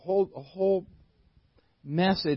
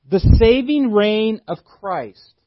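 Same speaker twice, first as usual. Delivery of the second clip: emphatic preaching; 3 semitones higher, 8 dB louder, slower than usual. Real, not acted. Preacher stressing the scripture ending.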